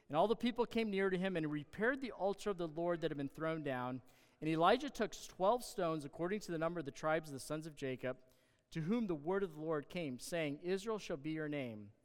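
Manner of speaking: usual speaking voice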